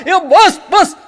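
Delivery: high loud trance cry; hammed-up possessed cry; mock shout. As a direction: shriek it in a trance. Possessed woman cries.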